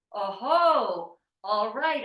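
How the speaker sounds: loud, drawn-out mock reaction; retold dismayed exclamation. Swami retelling news of a guru's death, loud exclamation. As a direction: exclaim it loudly, taking the news in.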